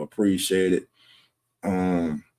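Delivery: ordinary delivery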